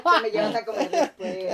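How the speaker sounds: loud, high, laughing through the words. Real, not acted. Joking to a friend about going hungry, laughing. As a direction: talk through big laughter.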